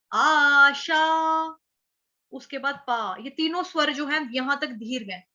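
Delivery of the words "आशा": आशा with both long aa vowels stretched slowly and loud; slow drawn-out demo. Shown as a demonstration. Teacher drawing out आशा for the class to copy. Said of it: "इसके उच्चारण की तरफ ध्यान देंगे".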